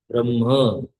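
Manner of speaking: ब्रह्म said brahm with the h sounded before the m; correct Sanskrit pronunciation. Shown as a demonstration. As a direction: say ब्रह्म as br-h-m, breathy h before m, no final a. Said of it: "ह पहले". (ब्रह्म का शुद्ध उच्चारण, ह पहले)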